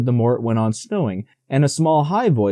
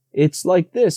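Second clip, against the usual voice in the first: higher little piglet, quick; Piglet, quickly; 6 semitones higher than usual. A narrator's reading. Piglet rescuing Pooh's explanation, higher and quick. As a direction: jump in fast in a small high voice. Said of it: "said Piglet quickly".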